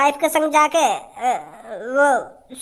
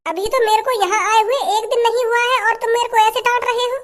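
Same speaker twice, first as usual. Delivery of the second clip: higher, plaintive bride's voice; a put-upon bride complaining she is scolded; hurt new bride; 5 semitones higher than usual. Acted. Bride protests husband scolding her on day one, higher plaintive voice.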